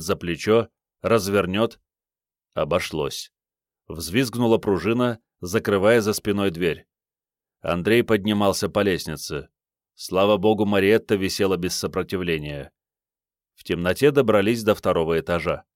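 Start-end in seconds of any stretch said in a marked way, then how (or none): none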